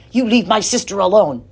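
loud protective yell. A narrator's reading. Tom yelling at the lady to protect his sister. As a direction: warn the stranger off, fierce. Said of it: "Tom yelled".

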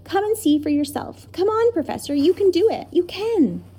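put-on a boy crying out with joy; excited cry. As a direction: burst out with the news, urging him on. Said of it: "he cried".

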